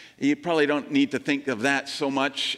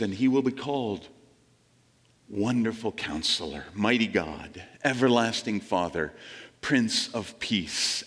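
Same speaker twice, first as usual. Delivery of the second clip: scripture reading; 5 semitones lower than usual. Acted. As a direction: let each name land gently, with long pauses. Reads Isaiah's prophecy aloud.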